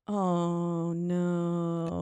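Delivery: long, level, held-out groan of words; hammed-up slow realization; drawn-out dread. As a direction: stretch each word out in mock dread. Acting Austin's dread, long held notes.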